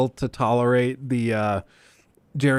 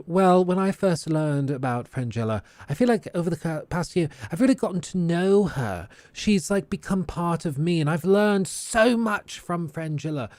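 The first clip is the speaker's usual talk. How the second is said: cringy, insufferable actor; 4 semitones higher than usual. Retold or imitated. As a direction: imitate a self-important actress pouring her heart out. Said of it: "personifies the cringy, insufferable type of actor".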